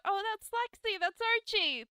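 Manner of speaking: put-on high, excited child voice; kids calling out. Kids recognising characters in a show, high excited shouting. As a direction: call it out like an eager kid. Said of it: "like, calling out".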